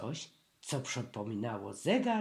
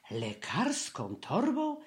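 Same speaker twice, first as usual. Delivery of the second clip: exclaiming; 5 semitones higher than usual. A narrator's reading. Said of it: "wykrzyknął Prosiaczek".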